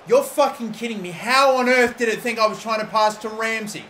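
exasperated outburst at the game; angry disbelief. Real, not acted. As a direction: snap in real frustration. Misplaced pass in game.

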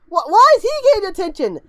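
high, loud disbelief; incredulous protest. Real, not acted. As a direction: demand to know why, voice shooting up. Protesting the unfair punishment.

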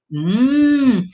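usual speaking voice

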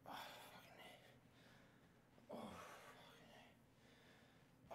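very slow, drawn-out strained whisper; strained groan. Real, not acted. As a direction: drag it out through the pain. Groaning through push-up pain.

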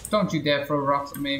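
usual speaking voice

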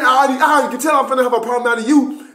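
very fast, high sergeant imitation; amused high-pitched retold warning; drill sergeant. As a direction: rattle off a warning as a mock sergeant. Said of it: "little dude that was yelling".

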